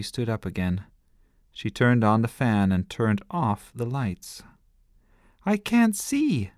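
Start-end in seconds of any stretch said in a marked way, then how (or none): 5.4-6.5 high, slow scared cry; frightened cry. A narrator's reading. Small brother cries out when lights go off. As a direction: wail it in the dark. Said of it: "cried Sebastian".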